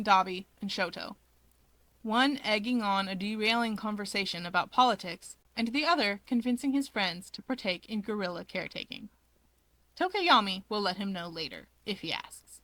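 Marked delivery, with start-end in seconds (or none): none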